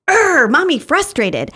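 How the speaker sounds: put-on little kid growling, then stating it plainly; toddler growl and statement. Toddler growls then names feeling.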